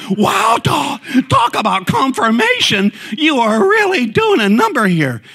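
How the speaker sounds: real amazement at the confirmation; amazed exclamation. Real, not acted. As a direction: cry out wow in real astonishment. Amazed the song matches.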